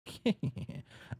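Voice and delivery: high put-on giggle; girl's giggle. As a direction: giggle in character as the girl. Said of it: "And she's like".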